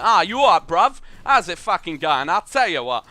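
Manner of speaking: put-on shouted British lad accent, "bruv" style; rough British lad accent. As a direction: bark it like a rowdy British lad. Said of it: "Like that".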